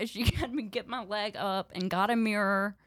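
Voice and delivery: ordinary talk, nothing marked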